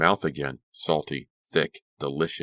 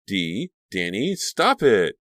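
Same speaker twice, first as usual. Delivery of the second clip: high, loud, slowed cry; startled cry; 4 semitones higher, 5 dB louder, slower than usual. Acted. Caroline startled, shouting at the fighting girls to stop. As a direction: call out to stop them.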